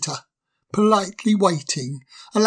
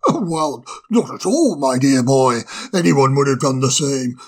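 put-on kindly old owl, a bit lower; a genial owl putting the guest at ease; wise old owl; 4 semitones lower than usual. Old owl reassures the fire sprite, kindly. Owl's voice, lower, with far timbre.

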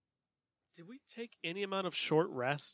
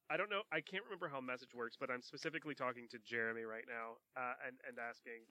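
quiet aside; 2 semitones lower, 8 dB quieter, faster than usual. Real, not acted. Aside about a private message.

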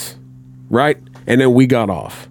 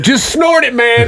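hammed-up dealer shout, pitched way up; mock pushy shout; 11 semitones higher than usual. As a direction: egg him on like a pushy dealer. Retold pusher urging to snort.

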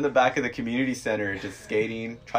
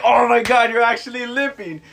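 1 mocking surprise; 10 semitones higher, 5 dB louder than usual. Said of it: "He's like".

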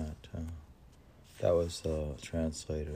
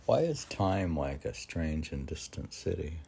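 normal talking voice

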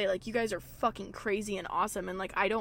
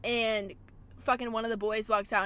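normal talking voice

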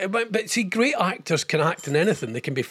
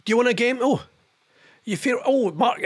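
ordinary delivery

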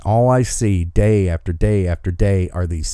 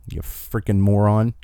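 ordinary talk, nothing marked

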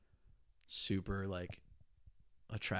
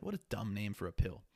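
ordinary delivery

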